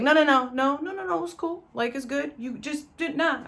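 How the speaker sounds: high, loud, rushed string of no's; frantic reassurance. Retold or imitated. To his coughing sister, begging her to stay: high frantic reassurance. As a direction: hurry to talk her out of leaving.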